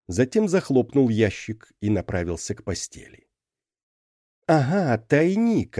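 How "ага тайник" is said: high, pleased exclamation; delighted. A narrator's reading. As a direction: cry out with glee at the find. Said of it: "обрадовал Джарлакс из шкафа".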